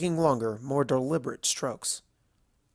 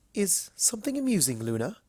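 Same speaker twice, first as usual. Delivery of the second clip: curious Rarity voice; 6 semitones higher than usual. Acted.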